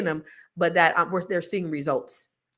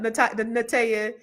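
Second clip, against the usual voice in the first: ordinary talk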